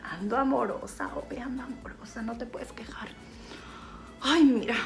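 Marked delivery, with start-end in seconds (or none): 0.0-3.1 soft whispered tease; whispered aside. Real, not acted. Aside to her husband Epifanio: playful whisper.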